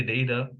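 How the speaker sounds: "data" opening like the word day; day-ta pronunciation. Shown as a demonstration. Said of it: "That's how I would pronounce it".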